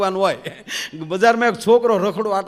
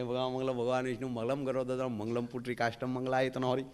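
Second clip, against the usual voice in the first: softer, lower muttered chant; mock mumbled prayer; 4 semitones lower, 10 dB quieter than usual. Barber lighting incense, praying softly. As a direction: rattle off the prayer under the breath.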